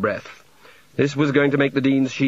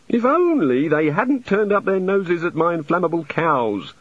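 high, fretful voice of a flustered god; a god embarrassed by his own temper; apologetic god; 6 semitones higher than usual. Acted. God voice continues.

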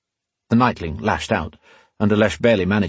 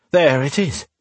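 pleased, higher-pitched announcement; satisfied; 5 semitones higher than usual. A narrator's reading. Rion as the manacles come free, satisfied. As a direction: sound satisfied with your own work. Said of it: "a satisfied tone in his voice".

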